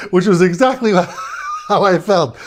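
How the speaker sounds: laughing through the words; can't hold back the laugh. Real, not acted. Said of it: "We started laughing at him".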